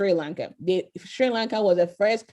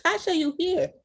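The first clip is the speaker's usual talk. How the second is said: surprised; 9 semitones higher than usual. Real, not acted. Surprised to find a guest.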